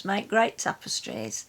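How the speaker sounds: ordinary delivery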